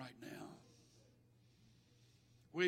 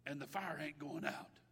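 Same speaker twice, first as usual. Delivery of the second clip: normal talking voice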